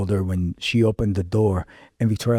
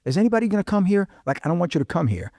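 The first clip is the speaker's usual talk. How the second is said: quick and high, panicky; frantic; 9 semitones higher, faster than usual. Retold or imitated. Friend in crisis on the phone, afraid someone is coming, frantic. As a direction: rush it out in a frightened spiral.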